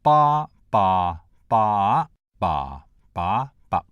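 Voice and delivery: "Ba" six times, each on a different Vietnamese tone. Shown as a demonstration. Lecturer demonstrating Vietnamese, "ba" sung out in six tones. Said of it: "showing how the six tones are said".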